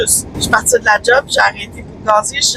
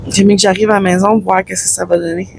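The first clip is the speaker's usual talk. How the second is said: ordinary delivery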